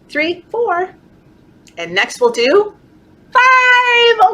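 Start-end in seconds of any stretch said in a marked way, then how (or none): 3.3-4.3 excited call. Real, not acted. Counting reveal.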